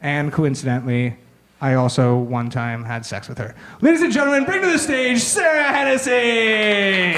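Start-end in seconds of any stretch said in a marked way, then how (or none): 3.8-7.1 big booming host intro, much higher; announcer hype. Acted. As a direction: call her on like a game-show host.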